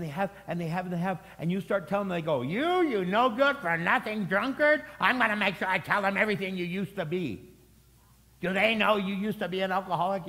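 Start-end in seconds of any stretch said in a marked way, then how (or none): 2.4-10.3 mock accusation. Churchgoer exposing someone's past, accusing. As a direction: throw the accusation out high and hard.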